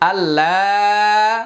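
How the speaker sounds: আল্লাহ with the আ held for three counts; long drawn vowel. Shown as a demonstration. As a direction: stretch the আ in আল্লাহ long and slow. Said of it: "এখানে তিন আলিফ টানতে হবে". (আল্লাহ-র আ তিন আলিফ টেনে)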